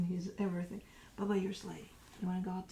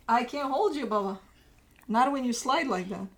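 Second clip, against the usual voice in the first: raised voice; 3 semitones higher, 7 dB louder than usual. Real, not acted. Owner scolding slipping dog.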